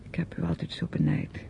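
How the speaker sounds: low, unvoiced confession; whisper. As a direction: whisper it, barely audible. Stille bekentenis in ruzie. (zacht fluisterend bekennen)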